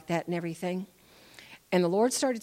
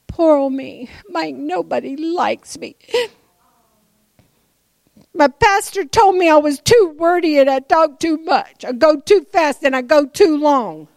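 mock self-pity; 8 semitones higher, 9 dB louder than usual. Real, not acted.

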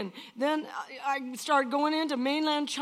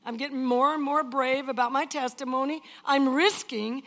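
louder, driving preacher's delivery; full-force preaching; 2 semitones higher, 7 dB louder than usual. Acted. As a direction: preach it out to the crowd.

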